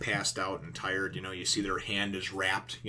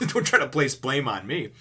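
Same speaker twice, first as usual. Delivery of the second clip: amused retelling through a laugh; laughing retort; 4 semitones higher, 5 dB louder than usual. Retold or imitated. Zoro refusing blame from Sanji, retort told through laughter. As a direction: let laughter break into the mock retort.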